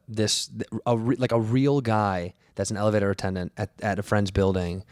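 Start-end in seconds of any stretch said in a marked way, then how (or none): none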